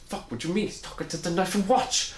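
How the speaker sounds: high-pitched, thick Portuguese accent imitation; Louis. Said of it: "The thick Portuguese accent".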